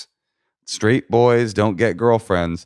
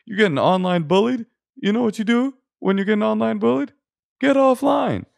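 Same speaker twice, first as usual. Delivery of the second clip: very high mocking comic voice; hacky comedian; 14 semitones higher than usual. Mocking comedians' classic hacky joke, high put-on voice. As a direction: mimic a hack comic's setup and punchline, pitched way up. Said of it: "these comedians that are like".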